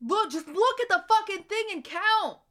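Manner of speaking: snapping at chat to just count; exasperated snap. Real, not acted. Streamer snapping at chat, exasperated.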